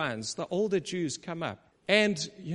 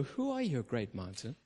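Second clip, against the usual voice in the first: looking down on your problem; dismissive challenge; 6 semitones lower, 7 dB quieter than usual. Retold or imitated. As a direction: toss it off as nothing. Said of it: "And you go".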